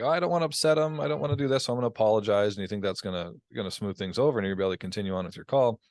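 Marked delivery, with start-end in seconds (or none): 0.0-2.6 high, fretful inner voice; mock the nervous caller's worry; mock anxious. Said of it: "you're just like".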